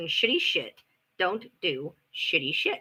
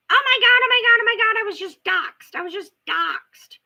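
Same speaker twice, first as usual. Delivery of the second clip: mock freaking out; 12 semitones higher, 5 dB louder than usual. Mocking the rival's panic to the viewers, high hysterical whine. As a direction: ham up a hysterical victim. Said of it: "and she's freaking out".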